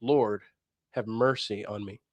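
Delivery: quiet, slow, sincere plea; slow earnest prayer. Shown as a demonstration. Critic shows how prayer should sound, slow and quiet. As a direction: pray each word with meaning. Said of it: "you don't say it like that".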